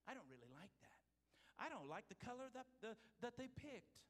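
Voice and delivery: lightly acted petty grumbling; mock complaint, starting soft then rising; fickle complainer. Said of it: "He hears the fickle".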